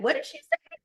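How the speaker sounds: very high mock baffled question; puzzled men. Men at the bottom asking, high and baffled. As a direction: play the confused men asking. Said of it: "You can hear the men".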